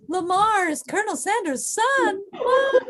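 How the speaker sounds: high, drawn-out melodramatic shock; soap opera reveal. Acted. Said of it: "that was a very soap opera reveal".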